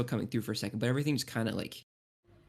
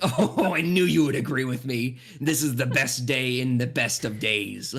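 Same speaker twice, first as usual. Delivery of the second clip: jolly gushing giant; 6 dB louder, slower than usual. Acted. Cotton Candy Bear delighted that Asoran agrees, loud. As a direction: exclaim like a delighted big bear. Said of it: "And he's like".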